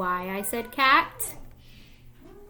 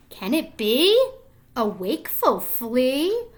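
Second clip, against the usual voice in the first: storybook surprise; 5 dB louder than usual. Acted. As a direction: build suspense over the flea. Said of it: "storybook surprise at the flea".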